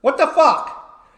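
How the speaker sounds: high, startled stop stop cut cut; bewildered ref stopping the bout; referee halting the fight. Retold or imitated. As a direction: referee stunned as the bottom gives up, calling cut. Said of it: "And it's like".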